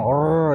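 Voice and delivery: long trilled "rr"; exaggerated roll on RR; over-rolled R. Shown as a demonstration. Teacher showing students what not to do, long trill. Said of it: "jangan gitu dong".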